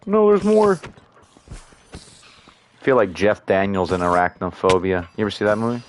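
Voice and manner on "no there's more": high, louder alarmed cry. Real, not acted. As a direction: jump up at the sight of more spiders. Sees more spiders appear.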